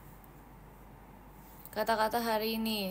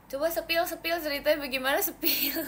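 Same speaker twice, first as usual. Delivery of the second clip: teasing call; 5 semitones higher, 4 dB louder than usual. Real, not acted.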